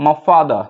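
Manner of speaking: 'my father' British-style, the final r left silent; British pronunciation. Shown as a demonstration. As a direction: say 'father' as FAH-duh. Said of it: "British বললে".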